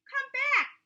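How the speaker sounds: high, loud call after them. A narrator's reading. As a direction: call out to the fleeing rabbits. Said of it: "called the rabbit".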